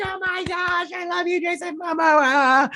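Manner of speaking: very high-pitched gushing; screechy, over-the-top fan excitement; fangirl squeal. Retold or imitated. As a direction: squeal adoringly at your favorite star. Replays fan reaction to favorite actor.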